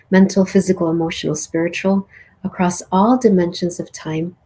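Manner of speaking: calm meditation guide closing the journey; guided meditation. Acted. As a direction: guide them gently through the closing.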